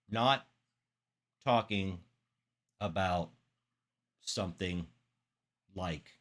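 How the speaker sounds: word by word with long pauses; far too slow. Shown as a demonstration. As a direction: drag it out, stopping after each word. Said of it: "That's not what I'm talking about".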